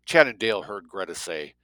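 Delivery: ordinary delivery, nothing marked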